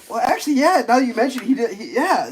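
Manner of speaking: high-pitched imitation of her backtracking; mock sheepish admission. Woman concedes her boyfriend was happy, mock sheepish. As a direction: raise the voice, hesitantly conceding.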